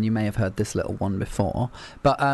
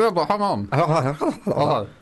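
mouth full; 4 semitones higher, slower than usual. Real, not acted. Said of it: "I'm filling my mouth with grapes".

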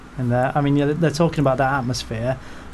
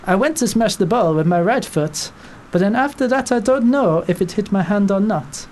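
mock French accent, higher pitched; 6 semitones higher than usual. Quoting the defender's excuse in a mock French accent. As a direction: ham up a French footballer's excuse. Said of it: "sounds like kind of Hercule Poirot".

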